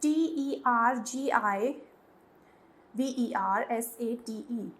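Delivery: spelling slowly for the class. Shown as a demonstration. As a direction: dictate each letter for notes. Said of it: "दूसरा इसका synonym होता है".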